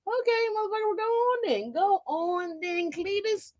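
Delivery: sarcastic retort. Pretend. Sarcastic retort to impatient people.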